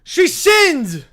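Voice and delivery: high, loud, hammed-up outrage; shouted accusation. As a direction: accuse her at the top of your voice. Acted character accuses the princess.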